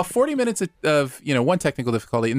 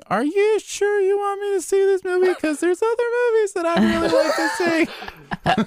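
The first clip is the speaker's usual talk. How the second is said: very high pleading voice; squeaky mock plea; high mock whine. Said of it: "getting a text from you".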